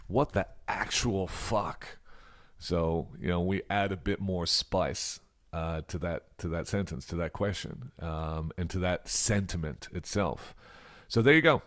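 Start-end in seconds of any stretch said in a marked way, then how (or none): 0.1-2.0 Australian phrase. Shown as a demonstration. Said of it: "In Australia, we also say". 11.1-11.6 upbeat wrap-up. Real, not acted. Teacher wraps up the lesson.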